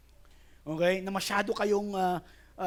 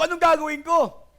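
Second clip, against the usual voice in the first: panicked cry; 11 semitones higher, 12 dB louder than usual. Retold or imitated. Stressed man retelling panic.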